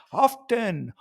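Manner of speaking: "Often" with the T sounded. Shown as a demonstration. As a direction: say "Often" as OFF-TEN. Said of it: "you don't say the T and often".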